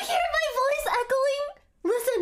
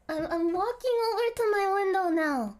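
ordinary talk

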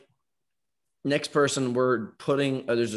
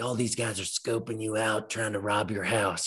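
stoned paranoid friend; 4 dB quieter than usual. Retold or imitated.

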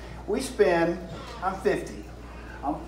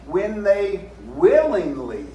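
willingly slow and weighted, louder; stress on willingly; 4 semitones higher, 4 dB louder, slower than usual. Shown as a demonstration. Preacher driving home the key word, stressed. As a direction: lean into willingly slowly. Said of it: "say willingly".

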